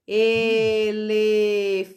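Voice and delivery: e-le-fan-te, very slow, each syllable split; slow syllable count. Shown as a demonstration. Teacher counting syllables with the pupil, very slowly. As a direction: stretch elefante out syllable by syllable. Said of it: "Vamos contar com a prof".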